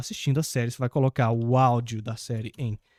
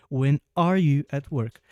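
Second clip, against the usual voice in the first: slow, careful English model sentence; slower than usual. Shown as a demonstration. Teacher slowly modelling the question for students. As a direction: spell it out slowly for the class. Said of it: "quando tem verbo to be, inverteu".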